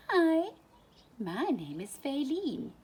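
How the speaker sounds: high, friendly little voice; bright girl fawn. A narrator's reading. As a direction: greet him cheerfully. Faline introducing herself.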